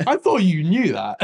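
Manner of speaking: slow, loud indignant protest; mock offended. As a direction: feign hurt disbelief.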